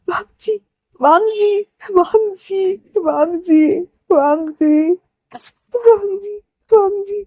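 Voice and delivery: high, drawn-out crying call; wailing grief. Acted. Brother calling out to dead Wang Ji, wailing. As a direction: cry out the name in a high wail.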